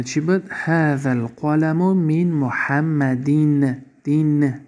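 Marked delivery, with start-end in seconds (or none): 2.2-4.5 stretched محمدین with a clear -in ending; tanween kasra ending. Shown as a demonstration. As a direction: draw out محمدین to a final -din, then repeat دین. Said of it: "یعنی این گرفت". (محمدین کشیده با تنوین کسره، صدای «این» در آخر)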